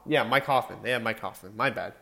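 ordinary talk, nothing marked

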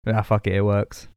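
ordinary talk

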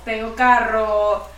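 "Tengo carro" drawn out slowly and proudly, mocking; slow mock brag. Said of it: "de poner estados en WhatsApp de".

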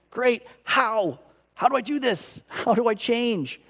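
throwing up his hands at the verse; exasperated asking. Retold or imitated. Exasperated quote of himself.

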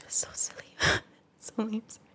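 choked-up whisper, barely voiced; breathy, tearful near-whisper. Real, not acted. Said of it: "I get a little choked up".

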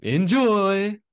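high, loud cheery pitch voice; chirpy fake salesman pitch; mock studio exec. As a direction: sell it like a smarmy studio rep.

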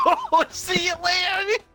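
high, slow, breathless with laughter, a flippant goodbye; real laughter swamping a mock farewell; laughing mock Spider-Man. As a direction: toss it off while cracking up. Mocking Spider-Man through laughter.

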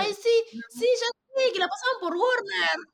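high, bright burst of "oh yes, I know it"; excited recognition. Real, not acted. Friend suddenly recognizes the old Warner show, high excited. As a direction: pitch jumps way up as she suddenly remembers.

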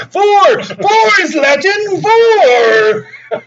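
high, loud hammed-up shout; mock demon yell. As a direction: scream it like an outraged demon. Demon insisting four is Latin.

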